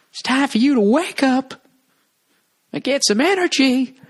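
high-pitched parody revival preacher; sing-songy preacher. Acted. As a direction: chant it high at the congregation, held on one note. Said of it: "that stupid sing-songy stuff".

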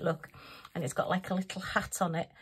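ordinary talk

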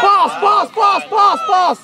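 loud, high, repeated sideline shouts; urgent shout. Real, not acted. Coach urging players to pass, urgent shouting. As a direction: fire the word out loudly over and over.

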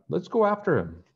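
rally the chase; eager call. Acted. George running off, calling eagerly.

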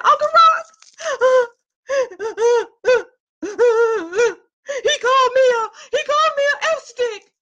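high, tearful retold sob with laughter; crying to uncle. Calling her uncle from the office, crying and high. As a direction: whimper it out like a hurt girl calling for help.

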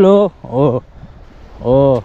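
usual speaking voice

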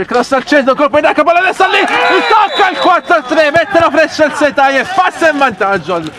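fast, high rush through the goal; breathless italian goal commentary. Real, not acted. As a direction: rattle it off at a gallop. Goal description, live commentary. (telecronaca di gol senza fiato)

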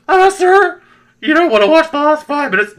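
very high, loud, timid voice; nervous documentary guy. Retold or imitated. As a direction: squeak out a nervous warning to the boss. Documentary guy warns exec.

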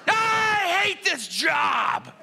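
slow, drawn-out high bellow; hammed-up howl of total exasperation; frustrated scream. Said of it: "he's getting frustrated".

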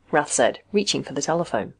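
ordinary delivery, nothing marked

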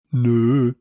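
loud, drawn-out objection; called out. A narrator's reading. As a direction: call out a blunt no. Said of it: "rief Samson".

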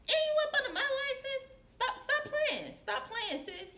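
high, laughing disbelief, telling her to quit playing; half-laughing, high-pitched call-out to a coworker; incredulous scolding. Retelling how she scolded the med aide, high and half-laughing.

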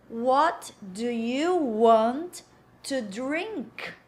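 slow, word-by-word "What do you want to drink?"; slow full form. Shown as a demonstration. Teacher modelling the question for learners, slow and separated.

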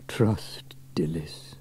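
soft, slow whispered sigh. Acted. Fireman Sam sighs about Dillis's bad fruit, whispered. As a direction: sigh it out, unsurprised. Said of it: "sighed Fireman Sam".

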